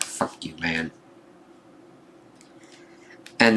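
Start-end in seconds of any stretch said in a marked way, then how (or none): 0.0-0.9 soft unvoiced whisper; a mock whispered fuck you; whispered curse. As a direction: whisper it under your breath like a sulking villain. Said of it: "his face being like".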